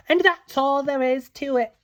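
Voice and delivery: sweet fairy voice, briefly; lightly voiced Lily. A narrator's reading. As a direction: finish explaining brightly. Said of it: "Lily said".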